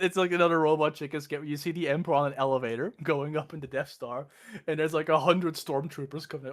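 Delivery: normal talking voice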